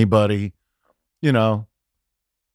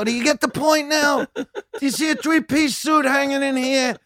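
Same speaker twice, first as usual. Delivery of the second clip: tough-guy rant; 12 semitones higher than usual. Retold or imitated. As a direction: bark it like the leather-jacket tough guy. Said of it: "and you go".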